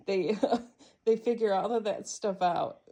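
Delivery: chuckling as she explains; laughing through it. Real, not acted. Owner amused by cats sniffing each other, laughing. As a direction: let the laughter spill into the words.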